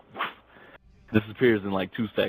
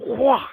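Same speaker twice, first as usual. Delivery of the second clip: loud, high smack noise; imitated smack; 6 dB louder than usual. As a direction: blurt the slap as a sudden loud sound effect.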